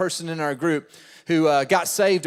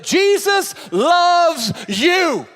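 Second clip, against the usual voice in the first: much higher, louder, slow shout; shouted punchline; 12 semitones higher, 6 dB louder, much slower than usual. Retold or imitated. Pastor delivering the keyed-car punchline, shouted. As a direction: belt it out slowly. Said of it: "He said".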